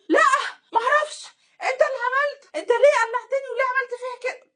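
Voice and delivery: very high, wailing accusations; mock tearful accusation. Wife blaming husband for undressing her, mock tearful hysterics.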